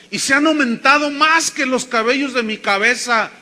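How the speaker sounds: high, loud preacher's declamation; declaimed scripture. Acted. Preacher quoting David's psalm to the congregation, declaimed loudly. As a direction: proclaim the verse at full voice.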